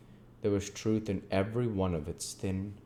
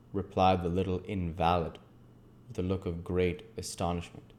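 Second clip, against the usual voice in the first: ordinary delivery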